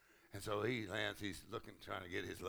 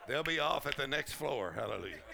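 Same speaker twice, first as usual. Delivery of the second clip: ordinary delivery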